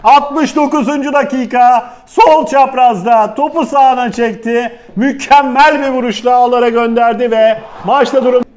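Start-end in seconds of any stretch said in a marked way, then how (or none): none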